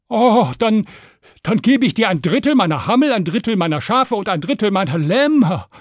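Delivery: high, loud, excited shepherd voice; eager shepherd. Acted. Shepherd promising Stan a reward for freeing him, eager. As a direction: burst out with a generous offer.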